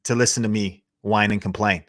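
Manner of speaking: normal talking voice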